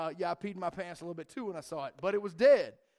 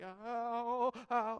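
mock hurt kid; 4 semitones higher than usual. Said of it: "limping around and like".

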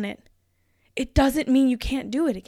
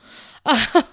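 emotional laugh; 3 semitones higher, 10 dB louder than usual. Real, not acted. Laughs after saying she's emotional.

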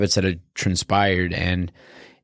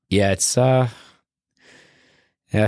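normal talking voice

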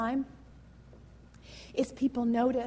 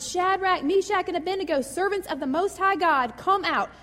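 higher, louder mock royal call; king calling into the furnace, raised voice; 6 semitones higher, 4 dB louder than usual. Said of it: "and shouted".

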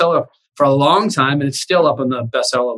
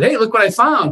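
higher, excited quote of his guy; mock eager worker; 8 semitones higher than usual. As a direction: play the proud finder. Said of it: "brought it over to me and said".

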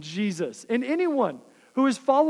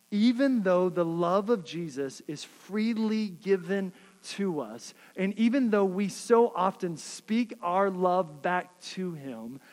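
normal talking voice

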